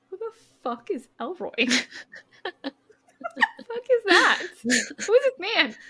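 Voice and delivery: high, laughing disbelief; who on earth is that, cracking up; baffled outburst. Retold or imitated. S0 voicing Kingfisher asking about Elroy, high laughing disbelief.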